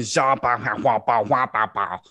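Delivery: mock French babble; a hint of French gibberish; fake French gibberish. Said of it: "the extent of his knowledge of fucking French".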